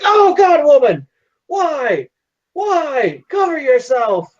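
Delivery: high-pitched, hammed-up horror; scandalized father's pleading yelp; flustered father. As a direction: play a mortified dad crying out in protest. Father shocked at undressed daughter.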